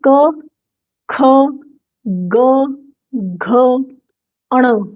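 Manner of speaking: क ख ग घ said one by one, fading down; consonant row drill. Shown as a demonstration. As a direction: sound out the velar row. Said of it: "एक ही line में जब हम". (क वर्ग का उच्चारण)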